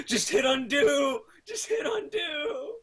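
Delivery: high, slow, laughing yell; laughing shout. Acted. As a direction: cry it out through laughter. Mocking repeat of friend's advice.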